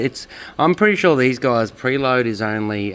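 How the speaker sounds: normal talking voice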